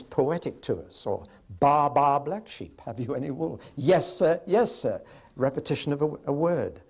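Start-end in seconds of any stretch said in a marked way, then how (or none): 1.6-5.0 high, bouncy nursery-rhyme delivery; lilting children's rhyme, higher voice; nursery rhyme recital. Shown as a demonstration. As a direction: chant it in matched pairs, pitched up. Recites nursery rhyme to show repetition.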